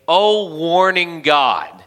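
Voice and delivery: slow, intoned mock address; drawn out like a solemn invocation; prayer voice. Shown as a demonstration. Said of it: "we're saying".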